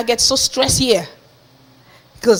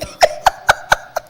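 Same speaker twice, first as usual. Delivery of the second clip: voiceless laughter at one's own misfortune; real laugh. Real, not acted. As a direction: break into a long unvoiced chuckle. Laughs at own money story.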